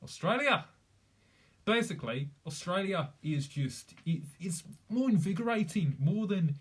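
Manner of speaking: lively put-on Australian accent; demonstrating an Australian accent. Shown as a demonstration. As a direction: exclaim it with a broad Australian twang. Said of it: "I can do Australian".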